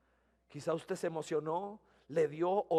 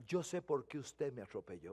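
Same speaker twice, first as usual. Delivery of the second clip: soft knowing remark; 6 semitones lower, 8 dB quieter than usual. Retold or imitated. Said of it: "le digo".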